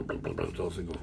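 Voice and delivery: plain reading voice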